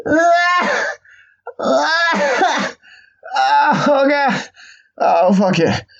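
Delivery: high, weak crying strain; pathetic straining whimper. Retold or imitated. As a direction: whimper under a weight you can't lift. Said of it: "I'd be lifting a weight like".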